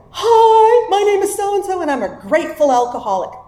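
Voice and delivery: high, bright mock-chipper AA greeting; mock cheery greeting. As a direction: parody the overly happy morning regular. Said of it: "ridiculous things like".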